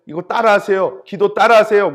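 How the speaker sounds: loud, insistent retold plea; urgent urging. Evangelist urging a dying person to pray, loud and insistent.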